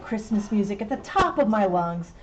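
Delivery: ordinary talk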